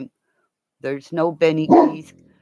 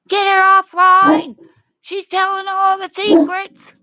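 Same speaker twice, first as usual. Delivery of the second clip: mock cops yelling; 8 dB louder than usual. Pet owner voicing cops, loud mock alarm. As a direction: shout it like panicky cops. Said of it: "the cops are going".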